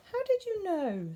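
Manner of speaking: high, astonished bear voice; lightly voiced amazed bear. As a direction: gasp it out in wonder.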